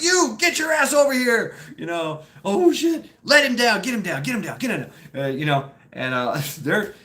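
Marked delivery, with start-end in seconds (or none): 0.0-1.6 high, loud MP yell; imitated angry order. MPs catching pranksters in the shadows, mock shouted order. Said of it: "Then they catch us". 2.4-5.2 high, loud start tumbling down into hurried repeats; mock panic. Caught pranksters lowering their friend, mock panicked scramble.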